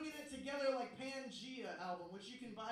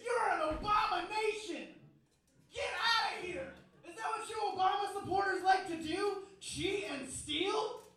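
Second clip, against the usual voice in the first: much higher, much louder shouting tirade; mock furious rant; 7 semitones higher, 10 dB louder than usual. Comic yelling at the fan who asked to rig the raffle, hammed-up furious shout. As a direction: yell her out of the room in mock outrage. Ranting at her over rigged raffle.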